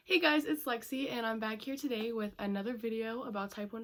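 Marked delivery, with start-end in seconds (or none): none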